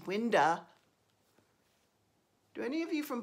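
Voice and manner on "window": winda, Baltimore style, no w at the end; imitating mother-in-law saying winda. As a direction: say winda with a dropped final vowel. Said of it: "as my mother-in-law says".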